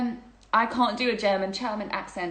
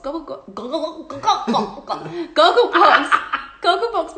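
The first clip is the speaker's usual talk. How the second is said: laughing; 5 semitones higher, 10 dB louder than usual. Real, not acted. Laughing fit over a word.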